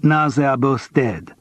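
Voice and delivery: put-on slow, heavy French accent, matter-of-fact; French accent, letting each word land slowly. A narrator's reading. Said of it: "Her English was heavily accented".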